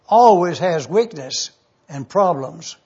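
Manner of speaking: ordinary delivery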